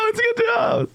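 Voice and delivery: very high hammed-up shout; mock congratulations. Imitating people who turn friendly after success, gushing shout. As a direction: shriek the praise like a fake pal. Acting out two-faced congratulations.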